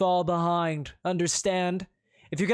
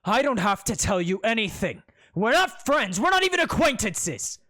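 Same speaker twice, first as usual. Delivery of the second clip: cold rebuff; 8 semitones higher, 8 dB louder than usual. Acted. Tight-jawed prince rebuffs.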